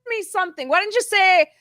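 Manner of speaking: high, loud, exasperated mock outburst; annoyed demand. Driver exasperated at her passenger for not saying anything, loud and high. [name] annoyed at missed tunnel.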